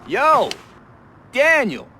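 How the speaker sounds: very loud, high-pitched holler; urgent shout. Acted. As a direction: yell across to stop the kid. Shouting at kid caught in mischief.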